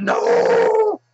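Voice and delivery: high piercing mock scream of denial; freaking out. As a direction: shriek it in horror. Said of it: "he's like".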